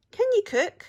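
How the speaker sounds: high, loud eager question; pirate chief voice. A narrator's reading. Pirate chief asks the troll, loud and eager. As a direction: ask it like a hopeful pirate boss.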